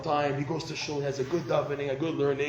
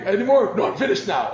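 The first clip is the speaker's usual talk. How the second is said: animated exchange; 6 semitones higher, 10 dB louder than usual. Retold or imitated. Husband and wife exchange, voiced.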